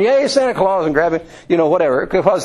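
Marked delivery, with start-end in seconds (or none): 0.0-0.8 put-on high, excited child's cheer; children greeting Santa at the parade, high and bright; kids cheering. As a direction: call out like a delighted kid at a parade.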